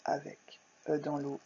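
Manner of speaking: whispered "œuf avec E dans l'eau". Shown as a demonstration. Teacher to pupils, whispering the spelling. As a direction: breathe the spelling softly.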